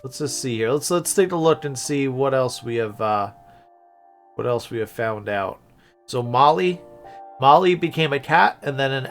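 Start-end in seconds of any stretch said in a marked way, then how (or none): none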